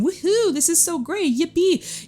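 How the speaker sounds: high, hammed-up woohoo; mock joyful cheer. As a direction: fake a giddy celebration. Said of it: "joyful and jumping up and down".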